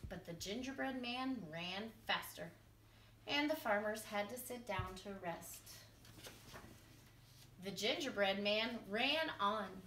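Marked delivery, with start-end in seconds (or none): none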